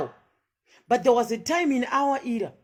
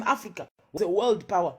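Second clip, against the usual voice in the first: ordinary delivery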